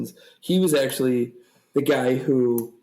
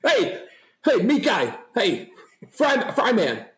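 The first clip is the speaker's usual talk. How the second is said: Carl quote; 6 semitones higher than usual. Retold or imitated. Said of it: "Carl was the best".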